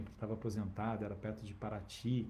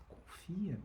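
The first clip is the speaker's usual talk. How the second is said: higher and much softer, gently urging; tender reassurance; 8 semitones higher, 6 dB quieter than usual. Retold or imitated. Reassuring his dying teacher, higher and much softer. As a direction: breathe the word out like a comfort.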